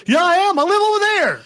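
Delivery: high, loud, hammed-up protest; indignant protest. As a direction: yell back defensively at a nosy stranger. Acts driver answering suspicious local.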